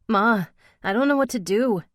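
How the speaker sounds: high, loud panicked plea. Acted. Mother panicking to Ma about sick child, panicked. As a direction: beg for help in a panic. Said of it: "I heard the panic in my own voice".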